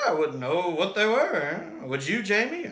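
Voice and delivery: hint of a sly sailor; [name] playing along. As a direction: feign ignorance, lightly voiced. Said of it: "[name]'s like".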